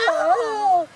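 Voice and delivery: high, loud cry of pain; pained yelp. Acted. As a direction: yelp as if the poem hurts. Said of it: "provoked this reaction from the Ford".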